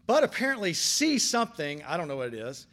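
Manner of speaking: ordinary talk, nothing marked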